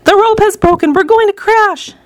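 high-pitched alarmed outcry; panicked cry. A narrator's reading. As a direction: cry out as if about to crash. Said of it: "Missus T V cried out".

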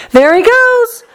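high, rising excited call; shouting. A narrator's reading. Onlooker spots the boy in the pipe, shouting. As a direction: call out the sighting, pitch way up. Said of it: "somebody shouted".

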